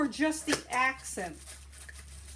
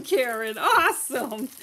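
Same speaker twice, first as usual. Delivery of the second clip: ordinary talk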